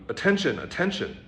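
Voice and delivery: loud and high, each word hammered home; ringing, repeated command; emphatic repetition. Acted. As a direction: insist on it like a master who won't budge. The master repeats his one word.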